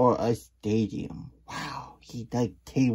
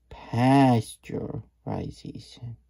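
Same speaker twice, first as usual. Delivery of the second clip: drawn-out careful syllables; slow sounding out; 5 dB louder, much slower than usual. Acted. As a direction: spell it out slowly by sound. Slowly sounding out word.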